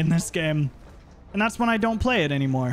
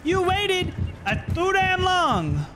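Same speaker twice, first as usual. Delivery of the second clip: high, slow drawn-out yell at survivor; sassy yelling; 11 semitones higher, slower than usual. Pretend. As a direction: stretch it out high and mocking. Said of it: "We're just yelling".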